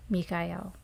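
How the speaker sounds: "Michael" said mee-kai-EL, with a k sound; Hebrew-like pronunciation. Shown as a demonstration. Host welcoming in the archangel, "Michael" as mee-kai-EL. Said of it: "Michael or Michael or Michael".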